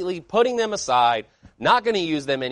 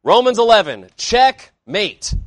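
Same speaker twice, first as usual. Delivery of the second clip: louder, higher mock proclamation; mock triumphant declaration; 4 semitones higher, 7 dB louder, slower than usual. Quoting the other man's meme post, mock triumphant declaration. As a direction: imitate someone loudly declaring victory. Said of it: "He said".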